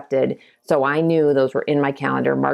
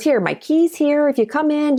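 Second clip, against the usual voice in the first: imitating Sue; 12 semitones higher than usual. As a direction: offer the key kindly, in a lifted voice. Sue voiced in the story.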